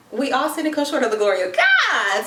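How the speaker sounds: high, hammed-up church testimony; mock preaching. Mock church line excusing her attitude.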